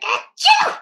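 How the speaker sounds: loud high mock sneeze "Achoo!"; imitated sneeze. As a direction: fake a big sneeze. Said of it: "how Pop sneezed".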